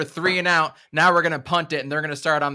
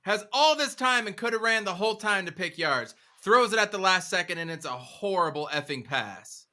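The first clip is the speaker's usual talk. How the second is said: escalating comedy rant; 5 semitones higher than usual. Real, not acted. Fan rant continues.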